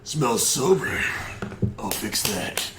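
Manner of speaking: comic voice sinking low at the end; put-on deep bit voice. Redoing the bit for a friend, mock-deep voice. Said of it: "You gotta do this again".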